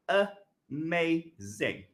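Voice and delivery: "Amazing" said very slowly, each of its syllables drawn out; slow syllable demo. Shown as a demonstration. Teacher drawing out "Amazing" for the class to count syllables. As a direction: stretch "Amazing" into its separate syllables. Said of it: "So the vowel, first vowel sound".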